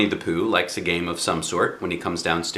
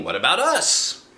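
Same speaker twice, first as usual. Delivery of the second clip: clamouring toys; 7 semitones higher than usual. Acted. Toy animals all clamour together.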